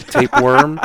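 cracking up mid-word, high and loud; laughing. Real, not acted. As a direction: burst out laughing on it. Laughing while listing ailments.

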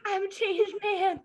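high, hammed-up proclaiming; mock grand declaration. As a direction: announce it like a melodrama hero.

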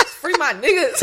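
high, drawn-out shout-out through laughter; high-pitched and cracking up; laughing shout-out. Real, not acted. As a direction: laugh as you slowly call it out.

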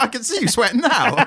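high voice cracking with laughter; laughing mock jeer. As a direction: jeer through giggles. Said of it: "it's like".

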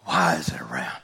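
hushed unvoiced whisper. Preacher whispering the question to the congregation, hushed. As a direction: drop it to a whisper.

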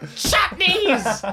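high, loud cry of "Chutneys!"; mock outburst. Player mocking his friend's odd chutney exclamation. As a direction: blurt it out like a silly discovery. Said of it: "and you're like".

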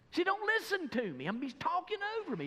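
high-pitched worked-up rant; hammed-up outrage at being interrupted; exasperated complaint. Preacher acts out being talked over, high exasperated complaint.

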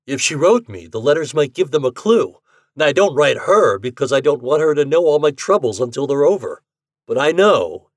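a hint of a cocky bettor; Ashton voice. A narrator's reading. Ashton explaining.

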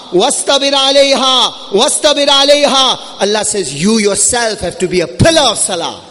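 impassioned sermon delivery; forceful preaching. Real, not acted. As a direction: preach it urgently, driving each phrase home.

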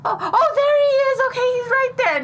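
very high mock-excited kid; hammed-up relief at finding someone; pretend excited discovery. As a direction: squeal as if you just spotted him. Acting child spotting her lost dad.

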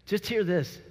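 normal talking voice

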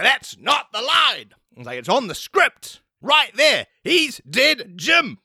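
high, slow mocking director voice; impatient director. Director Mario correcting the actor's reading, high and mocking. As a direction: scold him, then show how the line goes.

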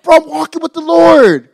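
mock a churchgoer showing off. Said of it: "We want people to think that".

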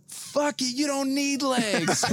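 put-on high, slow, slurred drunk cheer; hammered pep talk. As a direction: cheer him up like a wasted buddy. Drunk self consoling man.

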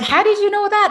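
high, loud imitation of an astonished question; retold surprise. Woman asked about her mob by a stranger, surprised.

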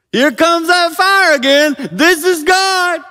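very high, loud, slowing at the end; excited shout. Acted. Elijah seeing fire come again, excited shout. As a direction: holler it like a sure sighting of God.

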